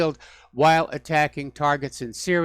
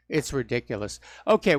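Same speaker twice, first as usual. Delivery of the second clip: ordinary talk, nothing marked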